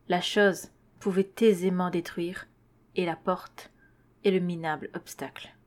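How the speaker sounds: ordinary delivery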